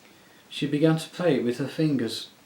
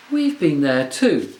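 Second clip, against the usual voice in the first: louder, slow, angry snap; snapping; 5 semitones higher, 10 dB louder than usual. A narrator's reading. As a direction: snap back angrily. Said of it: "snapped Jill".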